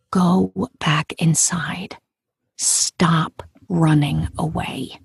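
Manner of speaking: slow, deliberate, spaced-out words; slow inner command. Retold or imitated. Thought telling a runaway to turn back, slow deliberate command. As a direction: let each word land slowly.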